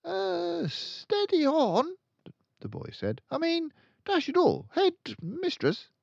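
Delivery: a flustered schoolboy; boy's voice. Acted. Boy pleading with the headmistress, high and flustered.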